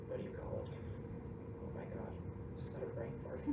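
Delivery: usual speaking voice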